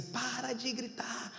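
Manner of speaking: a son softly begging his father to stop; imitating small son. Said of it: "ele já fica assim".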